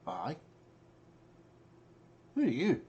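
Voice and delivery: god answering in the mind, rising in pitch; puzzled god, going high on the question. Acted. Game master as the god answering a caller, high questioning voice. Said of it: "You hear in your mind".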